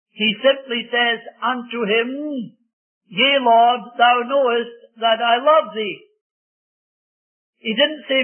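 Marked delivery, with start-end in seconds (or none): 3.1-6.1 fervent declaration. Acted. Preacher voices Peter's fervent answer.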